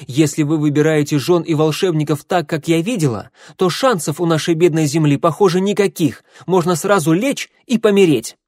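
loud, high, scathing tirade; heated, contemptuous outburst; angry rant. A narrator's reading.

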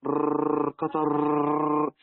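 قطر with the راء rolled long and slow like cutting cloth; drawn-out rolling r. Shown as a demonstration. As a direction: stretch the final رر of قطر into a trilling sound. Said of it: "بيعمل زي قطر". (راء ممدودة مكررة في قطر)

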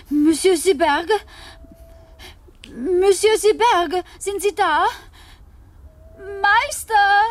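loud, high calling across the park; calling for the master in the empty park. Acted. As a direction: call out searching for him.

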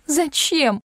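high-pitched, anguished cry; sobbing cry. A narrator's reading. Heroine demands an answer from Klim, tearful. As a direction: wail the question, near tears. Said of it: "зарыдала я".

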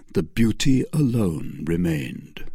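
usual speaking voice

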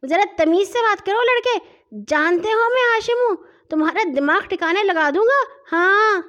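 angry high-pitched outburst; yelling. A narrator's reading. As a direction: shout threats like an affronted boy. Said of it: "اس نے چیخ چلا کر کہا".

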